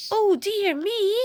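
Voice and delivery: ordinary delivery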